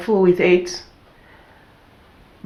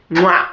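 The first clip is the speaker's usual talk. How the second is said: kiss sound. Real, not acted. Kiss sound after perfume.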